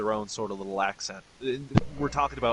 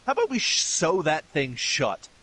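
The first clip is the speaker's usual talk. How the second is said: exaggerated disgusted suggestion; 5 semitones higher, 4 dB louder than usual. Fan reacting to a wrestler's French accent, exaggerated disgust. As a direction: start high and stretch out the words in mock exasperation. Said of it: "And I'm just like".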